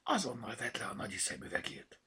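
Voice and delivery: high, half-whispered command; hissed order. A narrator's reading. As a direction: hiss it as a scolding order. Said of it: "sziszegte Bogi". (sziszegő, halk parancs)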